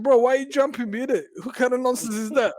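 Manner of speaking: high incredulous outrage; indignant retort. Retold or imitated. Telling the attacker off, high and incredulous. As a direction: throw it back at him indignantly. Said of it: "I said".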